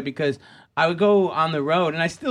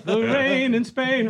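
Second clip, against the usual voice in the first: high, slow persona voice; Bobcat Goldthwait; 8 semitones higher, slower than usual. Shown as a demonstration. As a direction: stretch it out in a high comic-character voice. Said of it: "My impression of me".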